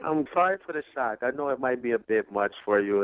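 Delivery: usual speaking voice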